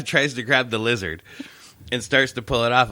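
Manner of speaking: ordinary talk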